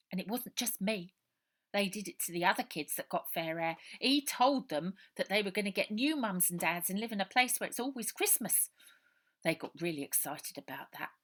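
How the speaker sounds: put-on a scared street boy pouring it all out; orphan boy Robert. Runaway boy telling Ida his story, loud and urgent.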